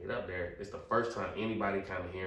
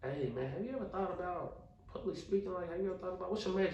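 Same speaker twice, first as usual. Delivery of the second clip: much higher-pitched imitation of a dean; put-on high voice, sounding out a student; 12 semitones higher than usual. Dean asks about public speaking, imitated in a high dean voice. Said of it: "that, that dean".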